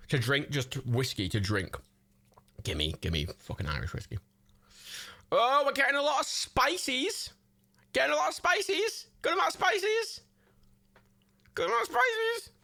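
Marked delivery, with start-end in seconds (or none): 5.3-12.5 rising sing-song. Acted. Reading spicy emotes, voice climbing.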